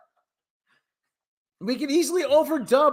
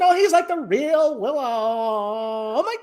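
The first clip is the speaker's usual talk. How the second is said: put-on Valley Girl accent. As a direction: stretch key words like a Valley Girl. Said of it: "have a Valley Girl accent".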